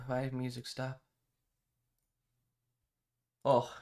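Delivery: ordinary delivery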